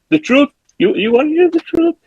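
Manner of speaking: very high, incredulous retort; hammed-up confrontation, voice shooting up; mock dramatic outburst. Acted confrontation over the truth.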